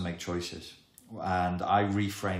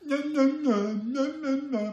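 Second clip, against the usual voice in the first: put-on much higher and louder, squeaky childish no no no; inner critic impression; 6 dB louder, slower than usual. Inner critic refusing everything, squeaky much higher and louder. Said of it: "That's my mature impression of your inner critic".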